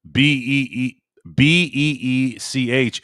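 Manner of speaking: slow letter-by-letter spelling of "B E E C H". Shown as a demonstration. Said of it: "It's supposed to be B E".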